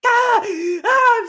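high, loud hammed-up wail of pain; mock pained cry. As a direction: cry out in fake agony, over the top. Rulad crying out in acted pain.

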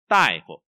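loud falling đại, light phổ; stress on đại. Shown as a demonstration. Teacher repeats the model word, đại stressed. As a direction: punch đại and let phổ go. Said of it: "cái này toàn đọc là đại phổ".

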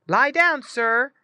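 loud, high firm command; dog command. Retold or imitated. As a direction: order the dog to lie down.

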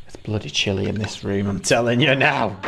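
ordinary talk, nothing marked

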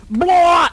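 loud retching blah; mock barfing shout. Showing the kid a seasick passenger barfing over the edge. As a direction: hurl it out like seasick. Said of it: "they're just like".

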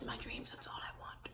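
soft unvoiced whisper; whispered longing. Real, not acted. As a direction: breathe it like a secret wish. Whispered wish.

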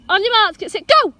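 quick playful race start; race countdown. Real, not acted. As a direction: get the dog ready to run.

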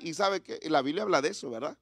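usual speaking voice

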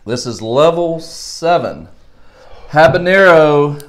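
slow, deliberate level call; slow announcement. Real, not acted. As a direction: draw it out like a host.

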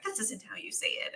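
hushed whispered correction. Retold or imitated. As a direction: whisper it like a critic muttering. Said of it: "they'd be like".

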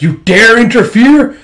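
high, loud, slow outrage; furious challenge. Acted. Wolf Queen facing her daughter, furious shout. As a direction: roar the insult of being stopped. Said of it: "He's like".

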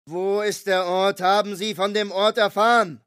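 mild mock interrogation yell; Myers yelling. A narrator's reading. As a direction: press him hard for the answer. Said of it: "brüllte Myers mich an".

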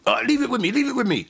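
high-pitched, keen reassurance; eager videographer. Retold or imitated. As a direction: imitate an enthusiastic friend taking on a job. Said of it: "when he says to you".

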